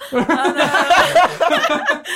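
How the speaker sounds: real laughing fit. Real, not acted. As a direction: try to carry on while giggling. Guest breaks up laughing at herself.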